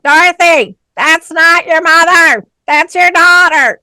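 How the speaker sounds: high, loud shrill call across the room; scream out. Retold or imitated. Woman in the clique screaming at Dorothy. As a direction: yell it out to correct her. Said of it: "she would always scream out".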